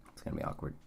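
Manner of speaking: breathless, voiceless whisper of it's gonna be awkward. Real, not acted. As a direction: drop it to a hushed, awkward whisper. Repeats the line, then whispers it.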